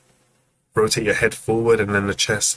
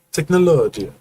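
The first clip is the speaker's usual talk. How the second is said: higher, louder Italian Technologia; Italian accent; 7 semitones higher, 4 dB louder than usual. Retold or imitated. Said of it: "In an Italian accent".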